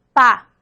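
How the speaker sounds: isolated bilabial "Pa" demo, lips closing; bilabial pa sound. Shown as a demonstration. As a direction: say "Pa" with both lips pressed then popped open. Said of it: "Involucra ambos labios". (pronunciación aislada de "Pa", con ambos labios)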